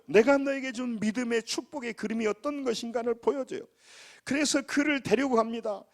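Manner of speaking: high, forceful sermon declaration; impassioned preaching. Real, not acted. Pastor declaring a life blessed before God, impassioned preaching. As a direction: proclaim it high with full conviction.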